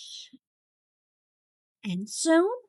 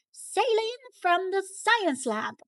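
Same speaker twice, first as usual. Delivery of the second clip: shrieking; 7 semitones higher than usual. A narrator's reading. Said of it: "he shrieks".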